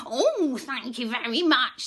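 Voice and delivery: lightly voiced duck; grateful duck. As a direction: thank him as the happy duck. Duck character speaks.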